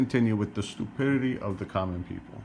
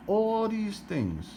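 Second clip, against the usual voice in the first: drawn-out emphasis; 10 semitones higher, much slower than usual. Real, not acted. Ranting about empty store shelves.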